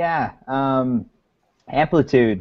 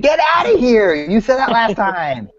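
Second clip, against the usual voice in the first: high, loud mock outrage; outraged disbelief; 11 semitones higher, 4 dB louder than usual. Real, not acted. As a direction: burst out in playful disbelief. Mock outrage at the Zune joke.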